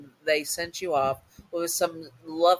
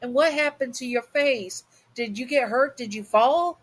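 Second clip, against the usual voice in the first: mama's voice pitched higher, anxious questions; a concerned mother fussing, higher; worried mama; 8 semitones higher than usual. Acted. Mother worried about daughter's face.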